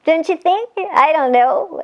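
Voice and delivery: plain reading voice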